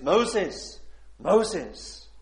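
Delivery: loud summoning call, name said twice. Acted. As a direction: call Moses from the burning bush. Voicing God calling Moses.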